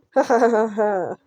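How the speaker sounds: manic killer's laugh in another voice; uncontrollable laughter. Acted. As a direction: laugh wildly like a deranged killer. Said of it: "Jeff's uncontrollable laughter".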